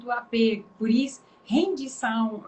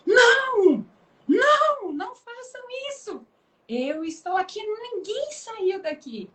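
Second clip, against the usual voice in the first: urgent plea; 10 semitones higher, 4 dB louder than usual. Acted. Paulo and Silas begging the jailer not to kill himself, with a crying shout. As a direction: shout to stop the jailer, then calm him. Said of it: "E Paulo e Silas dizem".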